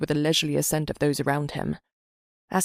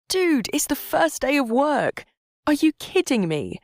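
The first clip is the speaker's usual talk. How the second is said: high, loud, incredulous; exasperated friend; 10 semitones higher, 4 dB louder than usual. Acted. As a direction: scold a late friend. Friend's exasperated text read aloud.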